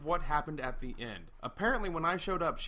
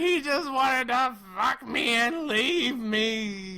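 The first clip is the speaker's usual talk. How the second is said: slow, loud mock yell, pitched up; 8 semitones higher, 9 dB louder, much slower than usual. Ex yelling through the wall, a slow loud mock yell. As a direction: holler it like a drunk ex through a wall. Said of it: "through the wall ... I hear".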